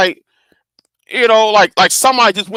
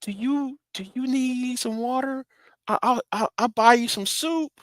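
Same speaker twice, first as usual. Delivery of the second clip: high, very soft, coaxing voice; imitated gentle mother; 8 semitones higher, 11 dB quieter than usual. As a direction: play the mother carefully offering help, high and hushed.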